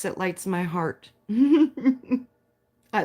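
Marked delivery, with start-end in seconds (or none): 1.2-3.0 laughing. Real, not acted. Laughing while reading comments.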